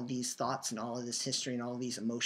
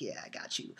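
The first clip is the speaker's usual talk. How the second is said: hushed, low aside imitating sister; soft, understated acknowledgement; quiet knowing reply; 6 semitones lower, 10 dB quieter than usual. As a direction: murmur it softly, almost voiceless. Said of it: "just kinda quietly nodded her head".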